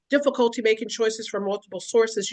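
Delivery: normal talking voice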